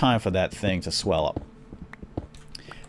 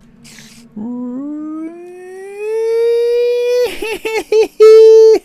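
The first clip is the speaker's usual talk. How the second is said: a long, high wailing cry, much louder; imitated screaming cry; 12 dB louder than usual. Dad retelling his daughter's scream: imitated screaming cry. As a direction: mimic a little girl's scream of pain. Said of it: "It was a cry".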